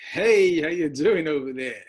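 mock call-out across a room; hailing greeting. Teacher likens the letter to a greeting, playful hailing. Mimics a casual greeting to illustrate Hey.

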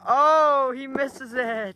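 loud, drawn-out excited call; excited shout. Real, not acted. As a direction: shout it out as the throw drops. Live commentary on the deciding miss.

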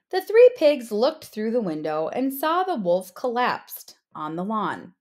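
ordinary delivery

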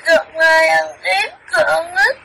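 tearful pleading through sobs; crying child. Acted. Child spirit begging grandmother, crying. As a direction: beg for help while weeping. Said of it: "Sao khóc rồi".

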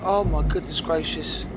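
ordinary talk

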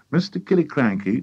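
ordinary delivery, nothing marked

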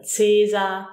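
Caesar begun with a TS sound; German pronunciation. Shown as a demonstration. Teacher giving learners a foreign-word example, Caesar with initial TS. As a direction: say Caesar as German "TSAY-zar". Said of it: "So we say".

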